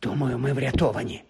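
тихо, потай, майже пошепки; скажи стиха, щоб не почули; притишений шепіт. Acted. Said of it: "прошепотів Гаррі". (прошепотіти)

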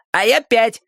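put-on high-pitched kid's reply; another child. A narrator's reading. Second child answering the first, high and eager. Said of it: "отозвался другой".

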